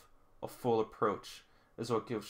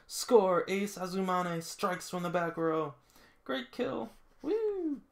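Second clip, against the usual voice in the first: excited cheer; 8 semitones higher, slower than usual. Real, not acted. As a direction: celebrate the point. Cheering the kill.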